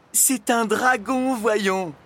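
high, loud, drawn-out obviousness; exasperated exclamation. A narrator's reading. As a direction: cry out the obvious answer. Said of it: "s'écria la prisonnière". (exclamation exaspérée, voix haute)

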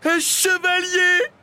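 loud, high, drawn-out thrilled shout; delighted cry. A narrator's reading. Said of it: "s'écria le dragon ravi". (cri ravi, aigu et fort)

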